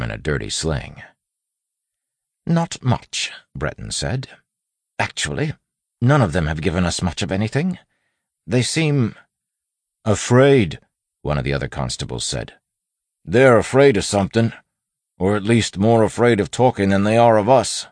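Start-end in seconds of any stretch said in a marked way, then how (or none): 2.4-10.8 lightly voiced constable, higher pitch. A narrator's reading. Constable reporting to Wayne on the silent bandits, voiced constable. 13.2-17.9 loud, high-pitched constable voice; emphatic constable. A narrator's reading. Constable explaining why prisoners will not talk, emphatic constable.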